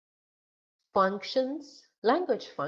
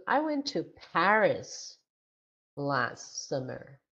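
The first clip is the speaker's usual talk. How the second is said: Paris stretched and emphasized, last summer dropping away; stress on Paris; 2 semitones lower than usual. Shown as a demonstration. Teacher modelling sentence stress for a class, stress on Paris. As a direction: lean on the word Paris. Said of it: "So the stress word is Paris".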